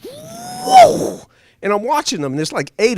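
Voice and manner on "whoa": high, slow astonished whoa; wide-eyed whoa as the jets scream past; awed whoa. Retold or imitated. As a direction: let the whoa soar up in awe.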